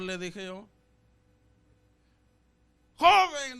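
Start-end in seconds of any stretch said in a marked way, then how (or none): 3.0-3.6 loud, high hail to a youth; evangelist calling out. Retold or imitated. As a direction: imitate a preacher calling someone over.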